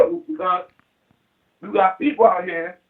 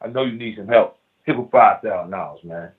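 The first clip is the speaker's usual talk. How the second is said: lower, earnest offer of help to a friend; lightly voiced generous friend; sincere giving; 11 semitones lower than usual. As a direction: talk down low like a brother who means it. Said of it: "I walk up to you and I say".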